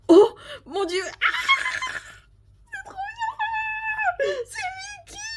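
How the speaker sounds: high shrieking fangirl squeal; ecstatic screaming. Real, not acted. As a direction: scream with pure joy, voice cracking. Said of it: "je viens de vous exploser les oreilles".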